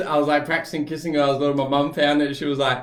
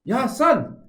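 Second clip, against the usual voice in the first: stepdad voice. Retold or imitated. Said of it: "He's like".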